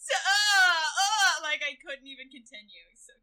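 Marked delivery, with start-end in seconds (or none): 0.1-1.3 high, loud swooning "uh, uh"; overwhelmed moan. Retold or imitated. Fan re-enacts seeing Danny in the video, overwhelmed moan. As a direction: wail it as if too overcome to go on. Acting out her overwhelmed first reaction.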